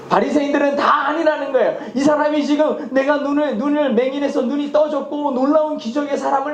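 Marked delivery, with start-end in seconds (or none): none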